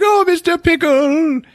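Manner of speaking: high, slow weepy falsetto; mock tearful falsetto. [name] tearful over his dog.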